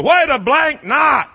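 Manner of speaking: imitated high yell, drawn out; screaming fan. Fan yelling at his wife, screaming. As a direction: mock-scream it slowly and high. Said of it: "I hear the guy behind me screaming".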